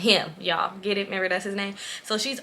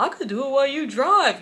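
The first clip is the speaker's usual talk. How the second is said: mock eager friend; 6 semitones higher than usual. Mimicking friend's offer to driver.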